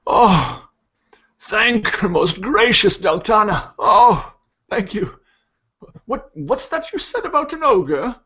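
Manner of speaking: put-on high-pitched old priest, gushing thanks then startled; relieved old priest. Priest in the wagon thanking Deltana, relieved. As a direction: cry thanks in a high voice, ending in alarm.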